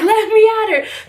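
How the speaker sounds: mock fired-up plea; itching to fight. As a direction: strain to get at her. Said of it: "I was like".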